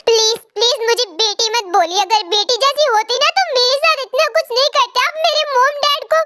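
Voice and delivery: ordinary talk, nothing marked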